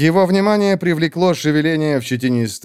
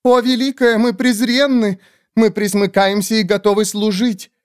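much higher squeaky groveling bird voice; fawning creature; 10 semitones higher than usual. Acted. Skeletal bird creatures grovelling before the great witch, high squeaky.

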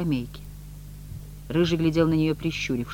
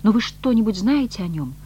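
higher imploring question, voiced; pleading; 6 semitones higher, 5 dB louder than usual. A narrator's reading. Woman asking after her lost love, pleadingly. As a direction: beg for any news. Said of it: "моляще шепнула Маргарита".

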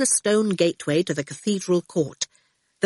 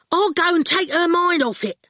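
high, raised offer; shouting; 11 semitones higher, 3 dB louder than usual. A narrator's reading. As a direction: shout it up to the cab. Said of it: "Ginger shouted".

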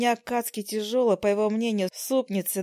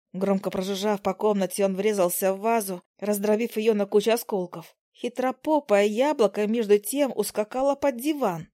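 ordinary delivery

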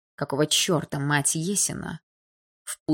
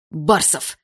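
loud, sharp reprimand by surname; stern, raised voice; angry director; 5 dB louder than usual. A narrator's reading. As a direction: call the boy to order angrily. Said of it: "вскочил на ноги рассерженный директор".

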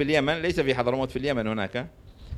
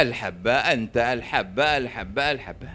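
patient persuading; 6 semitones lower than usual. Acted. Doctor slowly convincing the patient.

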